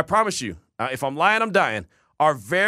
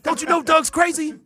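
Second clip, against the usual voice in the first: mock panic; 11 semitones higher, 3 dB louder than usual. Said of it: "I'm like".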